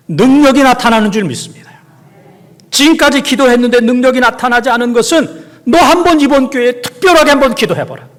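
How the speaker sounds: high, loud proclamation; climactic preaching. Acted. Preacher urges the congregation to pray, loud and high. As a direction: drive the faith home.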